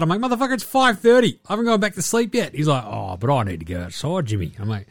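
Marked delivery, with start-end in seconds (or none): 0.2-2.5 high-pitched retold exasperation; worked-up, higher-pitched mock outrage; exasperated protest. Retold argument with early-rising dog. 2.8-4.5 low, softer put-on dog voice; lightly mopey talking dog; whining dog's voice. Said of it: "The dog is like".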